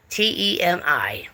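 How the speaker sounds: usual speaking voice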